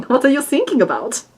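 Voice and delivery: mock German accent reply. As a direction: ham up a German coast guard. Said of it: "It's my attempt at a German accent".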